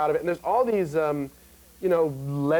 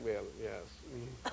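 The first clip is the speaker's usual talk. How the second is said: snooty British; 8 semitones lower, 18 dB quieter than usual. Retold or imitated. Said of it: "all the British actors kinda like".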